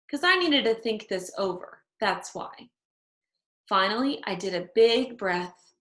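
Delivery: plain reading voice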